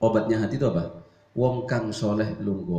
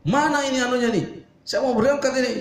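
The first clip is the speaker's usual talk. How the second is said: angry husband; 11 semitones higher, faster than usual. Acted. Husband shouting for his things before leaving, high angry demand. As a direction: bark for your things like a rushed husband. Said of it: "Banyak suami suka marah".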